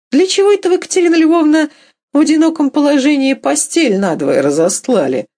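high-pitched, probing query; oddly pointed question. A narrator's reading. Husband questioning wife about the bed, oddly pointed. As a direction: ask suddenly, with a knowing edge. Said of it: "как-то мудрёно вдруг спросил".

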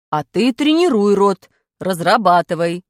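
slow, loud teacher's question; stern teacher quizzing class; strict teacher. Acted. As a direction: demand to know who has talent. Teacher retort.

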